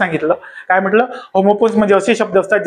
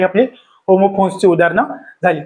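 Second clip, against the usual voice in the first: normal talking voice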